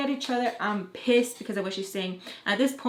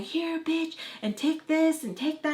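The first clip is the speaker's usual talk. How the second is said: angry; 7 semitones higher, slower than usual. Retold or imitated. Sister throwing diaper, swearing.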